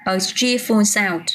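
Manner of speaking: slow, clear a cheerful sound; teacher repeats the phrase slowly for the class; slow model phrase. Shown as a demonstration. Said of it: "giọng, ok".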